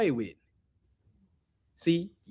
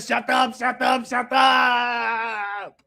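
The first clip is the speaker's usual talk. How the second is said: Arnold's mock yell; 2 semitones higher, much slower than usual. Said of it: "He said".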